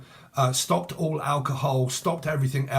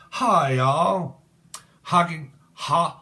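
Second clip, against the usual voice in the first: drawn-out mock Texan greeting; mock Texan accent; 3 semitones higher, 5 dB louder, much slower than usual. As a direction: have another go at a Texan twang. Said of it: "you don't sound anything like".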